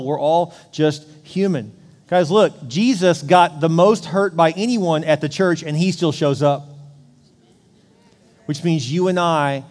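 loud, driving sermon delivery; preaching. Acted. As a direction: preach it to the whole room. Pastor preaching to congregation.

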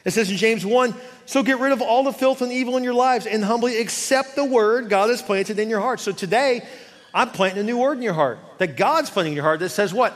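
loud, high-pitched proclamation; full-force preaching. Real, not acted. Preacher proclaiming forgiveness and shame off of you, full-force preaching. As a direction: preach it hard, stretching the big words long and loud.

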